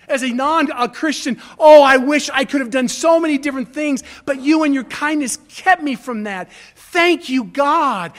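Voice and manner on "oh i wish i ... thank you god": loud, grateful prayer; impassioned personal prayer, raised voice; fervent prayer. Shown as a demonstration. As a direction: lift your voice in thanks to God. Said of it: "I hold the dialogue with God".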